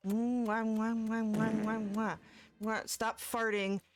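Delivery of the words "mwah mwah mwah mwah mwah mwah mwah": kiss sounds. Shown as a demonstration. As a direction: blow big kisses to the chat. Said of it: "V requested smooches".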